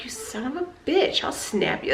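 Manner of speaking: seething at the man who laughed; muttered threat. Retold or imitated. To the laughing old man, hushed mock threat. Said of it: "Like, I was so mad".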